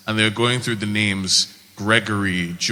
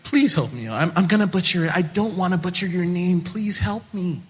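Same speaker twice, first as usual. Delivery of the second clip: high, softening plea; pleading; 6 semitones higher than usual. Retold or imitated. People asking how to say his name, pleading. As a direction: beg for help in a raised voice. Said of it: "people who actively ask me".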